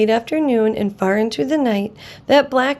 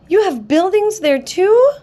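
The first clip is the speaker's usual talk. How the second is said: higher and louder, amazed question; gasping surprise; 8 semitones higher, 5 dB louder than usual. Acted. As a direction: gasp out the question in wonder. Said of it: "Horton gasped".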